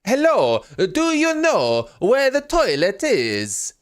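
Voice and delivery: high, slow put-on accent in English; exaggerated foreign accent. Tourist asking abroad for the toilet in put-on accent. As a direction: overdo a tourist's fake accent. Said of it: "speak in an accent".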